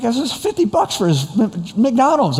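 high-pitched mock outrage at the bill; incredulous protest. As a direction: squeal in comic disbelief at the cost. Retold protest at a huge order.

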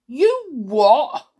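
a smoker cleaner snapping back; gruff cleaner. A narrator's reading. Dilly the cleaner challenging the children, loud gruff demand. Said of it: "demanded Dilly".

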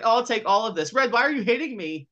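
high-pitched imitated victim; mock helpless protest. Bird getting beaten by Red, mock pleading.

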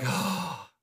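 long, soft breathy sigh; chagrined sigh. Retold or imitated. Preacher imitating Jesus exasperated with the people, chagrined sigh. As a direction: let out a voiceless, exasperated "oh". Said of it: "he sighed with chagrin".

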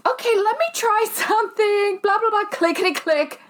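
high, chirpy Midwest gate agent; gate agent impression. Traveler imitating every gate agent ever, high and cheery. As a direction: squeak it like a sunny gate agent. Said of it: "And she was like".

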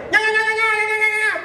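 mock babbling child. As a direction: babble like an older kid who never learned. Ten-year-old still babbling. (balbucio caricato, agudo e forte)

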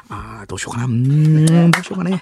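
long humming hesitations, sudden swells; Sato Jiro dithering. Acted. Actor struggling to give a riddle hint, hesitant humming impression. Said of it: "なぞなぞヒントを出そうとする佐藤二郎さん".

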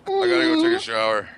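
squeaky joking voice, way up high; high mock voice. Mocking the brother in the closet, high-pitched. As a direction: pipe up like a goofy brother.

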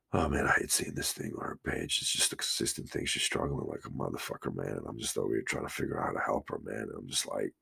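low, soft near-whisper; hushed sadness. Real, not acted. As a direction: confide it, deflated. Sad confiding about struggling friend.

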